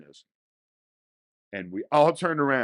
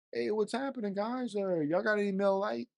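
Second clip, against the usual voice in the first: high, goofy hey-guys voice; mock-casual high greeting to strangers; goofy friendly greeting; 9 semitones higher, 3 dB quieter than usual. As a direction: breeze in sounding overly friendly.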